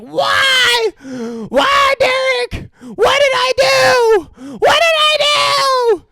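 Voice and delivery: very high, loud crying wail, slowed; hammed-up sobbing shriek; mock wailing mother. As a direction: wail it like a heartbroken mom. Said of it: "his mom runs up and she's like".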